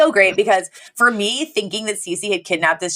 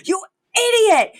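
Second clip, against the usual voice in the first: much higher, slow mock outraged shout; Niles, hammed-up insult; 13 semitones higher, slower than usual. Butler scolding the housekeeper over the kidnapped child, high mock shout. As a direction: play the appalled butler shouting at her. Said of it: "he goes".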